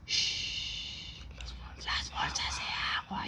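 slow hushed whisper; sleepwalker whisper. Retold or imitated. Sleepwalking sister hushing the family, slow whisper. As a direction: hush them, eerily slow.

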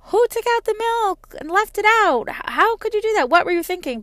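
high, loud mock scolding; annoyed yelling. Scolding the kids about milk left out, mock angry yell. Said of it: "yelling at each other and getting annoyed".

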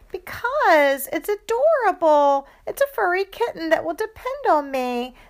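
very high, cooing gush; gushing over kitten. Acted. Sister gushing to mom about an adorable kitten, high cooing.

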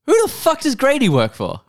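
high, loud disbelief; incredulous. Real, not acted. Podcaster shocked by the plot twist, loud disbelieving outburst. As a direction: blurt it out in shock.

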